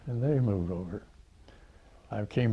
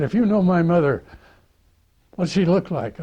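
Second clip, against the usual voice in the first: higher, louder cocky demand; challenging kid; 8 semitones higher, 7 dB louder than usual. Retold or imitated. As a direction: dare him to prove it like a smart-aleck kid.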